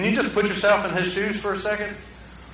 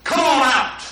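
calling out; 5 semitones higher, 6 dB louder, slower than usual. Retold or imitated. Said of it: "He said".

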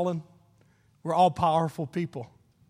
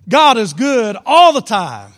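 loud, slow, rousing call to the crowd; preacher fires up the congregation with a loud call; enthusiastic call; 3 semitones higher, 10 dB louder than usual. Shown as a demonstration. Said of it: "say it enthusiastically".

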